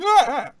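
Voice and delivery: high, loud cartoon scream of defeat; hammed-up ghost scream; spirit's shriek. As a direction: shriek as you're thrown out.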